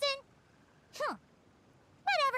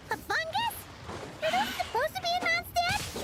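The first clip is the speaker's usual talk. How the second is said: surprised, high question; 9 semitones higher than usual. Acted. Paimon spots strange fungus.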